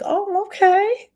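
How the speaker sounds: high, drawn-out, impressed "Oh, okay"; impressed viewer. Retold or imitated. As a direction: play a viewer who is suddenly impressed. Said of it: "you want them to be like".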